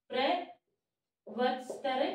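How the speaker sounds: drawn out very slowly, syllable by syllable; slow dictation. Shown as a demonstration. Teacher dictating a Sanskrit word to students, very slowly. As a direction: stretch प्रवचतर out so students can write it. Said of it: "प्रवचतर (said once before)".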